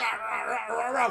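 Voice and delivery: growling Rah, rah like a bear; growly bear. Retold or imitated. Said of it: "you sound like a growly bear".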